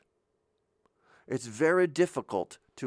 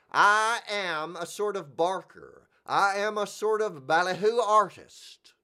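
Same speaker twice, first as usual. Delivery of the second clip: barker voice; 7 semitones higher, 4 dB louder than usual. Pretend. Carnival barker fantasy.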